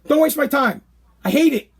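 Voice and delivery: ordinary talk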